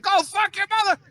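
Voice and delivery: high-pitched retold yell; road-rage yell. Driver honking back at the other car, angry yell.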